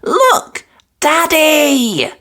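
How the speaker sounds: excited call. A narrator's reading. Peppa calling her dad.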